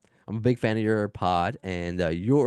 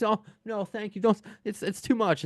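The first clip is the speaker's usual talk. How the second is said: high, coy fake-modest celebrity voice; imitating a celebrity pretending to refuse praise; mock modest celebrity; 12 semitones higher than usual. Mocking celebrities who fish for compliments, in a high coy voice. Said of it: "those celebrities".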